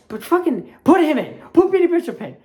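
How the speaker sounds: loud exasperated plea; frustrated demand. Real, not acted. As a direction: yell it at the coaches. Demanding coach play him.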